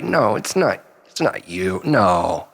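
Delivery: usual speaking voice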